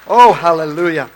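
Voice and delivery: ordinary talk, nothing marked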